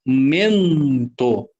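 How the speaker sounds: MENTO slow, the nasal EN held long; drawn-out nasal. Shown as a demonstration. Teacher pointing out a vowel digraph, mento drawn out. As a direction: stretch the EN in mento before the TO. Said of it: "um dígrafo vocálico".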